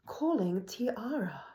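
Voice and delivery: slow, measured British phone-assistant impression; imitated female British virtual assistant; British Siri voice. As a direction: announce "Tiara" drawn out with a British accent. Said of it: "a female British voice".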